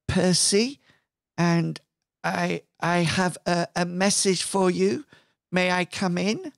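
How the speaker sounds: halting, tentative request to enter; timid caller pausing between phrases; Percy. Acted. GM voices timid visitor at door.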